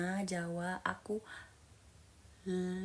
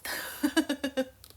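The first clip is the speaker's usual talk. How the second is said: high giggling laugh; giggle; 5 semitones higher than usual. Real, not acted. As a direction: giggle in a quick run. Laughs at chat.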